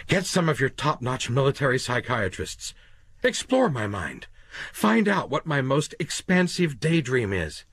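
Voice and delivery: a cornered man talking fast to save himself; desperate Quayle. Acted. Quayle keeps pleading.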